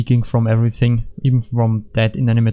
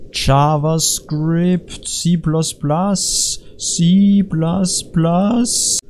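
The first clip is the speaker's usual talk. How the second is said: very slow, stretched words, raised pitch; drawn-out incantation; 5 semitones higher, much slower than usual. Pretend. Player spouting incoherent references, drawn-out incantation. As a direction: stretch every syllable right out.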